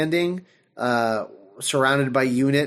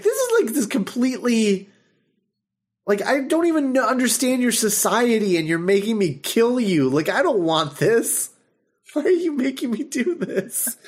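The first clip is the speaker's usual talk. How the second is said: pleading alien; 7 semitones higher than usual. Retold or imitated. Alien pleading in retold scene.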